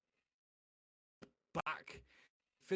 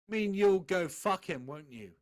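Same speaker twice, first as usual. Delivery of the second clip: angry shout; 3 semitones higher, 8 dB louder than usual. A narrator's reading. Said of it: "shouted angrily".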